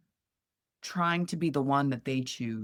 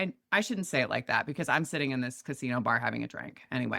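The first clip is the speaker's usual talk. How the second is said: ordinary delivery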